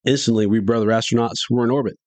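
ordinary delivery, nothing marked

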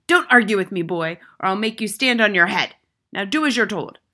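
loud, threatening command; domineering schoolmistress shout; tyrannical headmistress, angry. A narrator's reading. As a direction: silence the boy with a forceful order.

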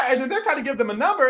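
ordinary talk, nothing marked

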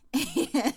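bright burst of laughter; big laugh; loud laugh. Real, not acted. Boss of the house laugh.

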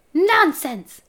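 high, loud brush-off; dismissive. A narrator's reading. Homunculus to the shrieking dwarf, loudly dismissive. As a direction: scoff it out loudly.

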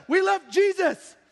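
high, loud and slow wail; hammed-up frantic mother; mother's mock cry. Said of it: "she's like".

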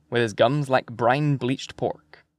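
usual speaking voice

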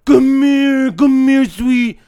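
high, loud, repeated coaxing call; mock dog-calling. Strangers calling a dog on the street, mock dog-calling. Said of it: "they start calling to the dog".

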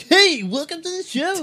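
high-pitched, hammed-up host welcome; over-the-top peppy greeting. Shown as a demonstration. Host showing co-hosts the opening he avoided, exaggeratedly peppy. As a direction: burst in with exaggerated pep. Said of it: "Instead of being like".

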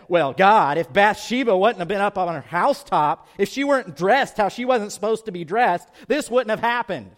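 a loud, pleading, self-excusing David; hammed-up excuse to God, loud; mock excuse-maker. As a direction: whine the excuse like blaming someone else. David's hypothetical excuse acted out.

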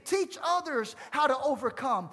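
rousing pulpit exhortation; impassioned preaching. Real, not acted. Preaches with rising force.

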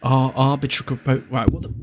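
ordinary talk

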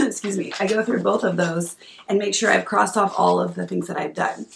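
ordinary talk

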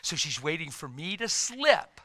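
ordinary talk

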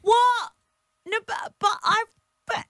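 surprised. Real, not acted. Told cake comes back at her.

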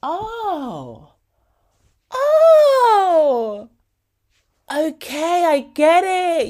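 high, excited outburst of realizing; sudden realization. Retold or imitated. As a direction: burst out as it finally clicks. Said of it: "I was like".

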